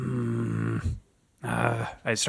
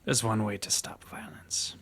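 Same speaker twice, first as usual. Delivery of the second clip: menacing whisper; 6 dB quieter than usual. Acted.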